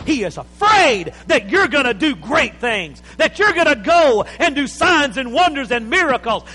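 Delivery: loud, high, driving sermon climax; preaching at full force. Acted.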